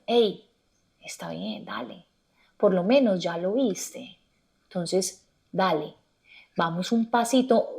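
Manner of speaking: soft, slow reassurance; self-compassion. Retold or imitated. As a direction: ease yourself forward gently. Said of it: "muy compasiva con mis propios procesos".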